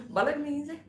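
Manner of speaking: Bolognese pitched high with a rising lilt; teasing Italian Bolognese; mock Italian Bolognese. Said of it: "I might have Italians".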